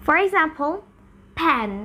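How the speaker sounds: usual speaking voice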